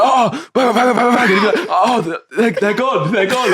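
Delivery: high, laughing imitation of a mate; mock callout. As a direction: ham up a panicky gamer callout. Mate's fake gunfire callout retold.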